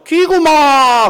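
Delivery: high, loud, very slow Jeolla drawl; drawn-out Jeolla auntie reply; Jeolla dialect. Acted. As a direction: stretch out the Jeolla answer. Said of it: "그분이 또 전라도 아줌마예요".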